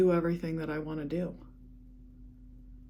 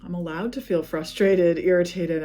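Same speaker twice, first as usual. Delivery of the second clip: louder, letting the feelings out; 10 dB louder than usual. Real, not acted. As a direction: declare it loud and firm. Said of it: "feel good to say it out loud".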